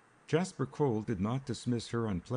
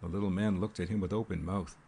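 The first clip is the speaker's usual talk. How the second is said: usual speaking voice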